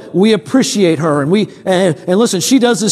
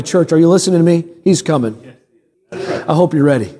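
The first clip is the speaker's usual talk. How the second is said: usual speaking voice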